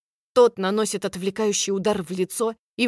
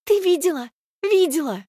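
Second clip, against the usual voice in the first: high breathless gushing; excited; 8 semitones higher, 5 dB louder than usual. A narrator's reading. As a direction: gush with delight.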